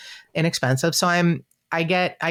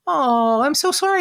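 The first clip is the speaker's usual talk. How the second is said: high, swooping sympathy; Keith Morrison; 12 semitones higher than usual. Retold or imitated. TV idol hears of widow's loss, high and sympathetic. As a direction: lift way up in consoling surprise. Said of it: "And he goes".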